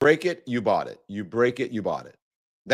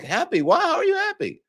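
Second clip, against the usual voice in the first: high-pitched disbelieving questions; mock incredulity. Trump supporters questioning the speaker's happiness, mock incredulity. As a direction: imitate baffled opponents, pitch climbing sharply. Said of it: "They're like".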